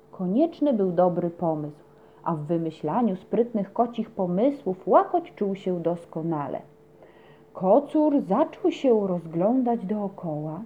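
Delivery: usual speaking voice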